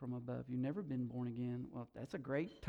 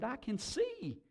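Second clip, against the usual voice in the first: high, drawn-out wonder; proclaiming; 9 semitones higher, slower than usual. A narrator's reading. Woman discovering sight by the fire, wondering proclamation. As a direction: lift the voice and stretch the discovery. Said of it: "she proclaimed".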